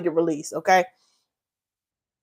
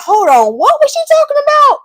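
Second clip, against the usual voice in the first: mock confused newcomer, much higher on the question; confused viewer; 3 dB louder than usual. New viewers clicking the stream, mock confused and high. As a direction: play a baffled newcomer joining mid-conversation. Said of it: "they're like".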